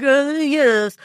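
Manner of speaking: put-on rudely French-accented hesitation. Playing a rude skeptic in a French accent. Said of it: "So naturally I went French with it".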